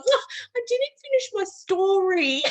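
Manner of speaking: high-pitched playful complaint; re-enacted indignant whine; mock protest. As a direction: protest as if cheated of your turn. Re-enacts protest after laughing fit.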